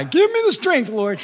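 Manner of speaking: very high, strained begging; desperate mock plea. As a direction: plead as if at the end of your rope. Same plea a year later.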